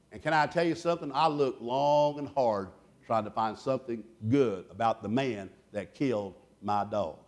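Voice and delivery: ordinary delivery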